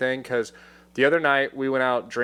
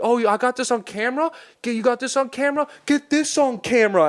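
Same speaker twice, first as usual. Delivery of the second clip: much higher, jeering taunt at the camera; taunting, riled up; 11 semitones higher than usual. Retold or imitated. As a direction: goad him to film it.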